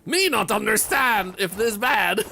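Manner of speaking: hammed-up caveman grunt-speak. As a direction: play a clueless caveman, slowly, in a loud high voice. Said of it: "Why did I start talking like that?".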